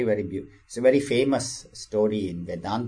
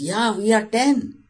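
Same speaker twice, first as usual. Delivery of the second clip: high, bright squeal of relief; joyful relief; 12 semitones higher, 4 dB louder than usual. Acted. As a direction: burst out like a relieved child. Disciples realise they are ten.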